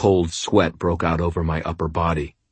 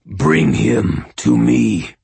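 slow, purring inhuman creature, swelling louder; purring monster voice; 5 semitones higher, 6 dB louder, slower than usual. A narrator's reading. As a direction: savour each word like a predator. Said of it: "it said".

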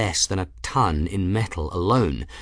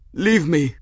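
high, strained gasping plea; weak gasp; 7 semitones higher than usual. A narrator's reading. Pinned monk to his rescuer, gasping. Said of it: "the monk gasped".

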